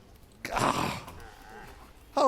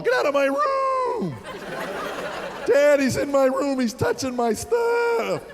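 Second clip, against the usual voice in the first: shrill, much higher, slow screaming whine; high-pitched yelling; 7 semitones higher, slower than usual. Retold or imitated. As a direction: scream like a kid whose voice never broke. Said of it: "He'd start yelling".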